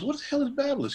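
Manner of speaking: a sudden high-pitched question; baffled. Retold or imitated. Asking himself about the clipper brand, high and baffled. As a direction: sound thrown by a strange name.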